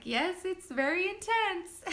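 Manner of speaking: high, slow imitation of an amused nurse; imitated amused nurse. Nurse laughing with labouring mom, amused agreement. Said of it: "my nurse just starts laughing".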